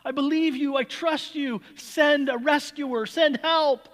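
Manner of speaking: high, loud, slow pleading cry to God; desperate cry. Retold or imitated. Stranded man on his roof crying to God, desperate loud plea. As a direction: beg heaven for rescue at the top of your voice. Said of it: "he cries out and he says".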